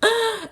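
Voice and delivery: high gasp of shock; sharp dramatic intake of breath; re-enacted horrified gasp. Acts own shocked reaction.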